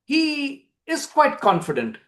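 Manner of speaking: ordinary delivery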